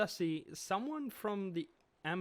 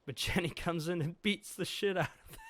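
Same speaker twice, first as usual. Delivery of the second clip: usual speaking voice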